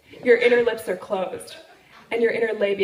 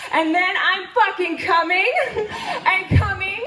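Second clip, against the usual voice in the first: starts even, climbs steadily higher and louder into breathless glee; building excitement; 6 semitones higher, 3 dB louder than usual. Retold or imitated. Telling the crowd about climaxing at the workshop, building from calm to giddy. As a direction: let each repeat climb until it tips over into laughter.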